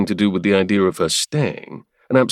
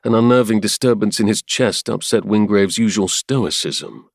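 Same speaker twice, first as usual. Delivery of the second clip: ordinary delivery, nothing marked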